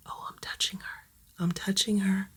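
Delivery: hushed whisper of delight; excited whisper. Real, not acted. Petting the nervous kitten for the first time, whispered. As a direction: whisper so the kitten isn't startled.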